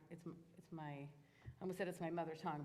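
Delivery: ordinary talk, nothing marked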